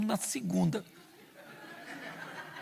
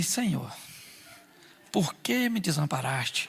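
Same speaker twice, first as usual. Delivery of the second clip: low, soft lament to heaven; mock despairing prayer; 4 semitones lower, 6 dB quieter, slower than usual. Man crying out to God, quiet and mock-despairing. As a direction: sigh it out like a forsaken man.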